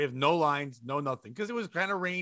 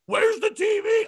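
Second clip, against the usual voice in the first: high, drawn-out scream imitating a customer; much higher, louder, stretched-out yell; yelling lady; 11 dB louder, slower than usual. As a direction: mimic a frantic shopper screeching across the store. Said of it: "a lady yells out loud".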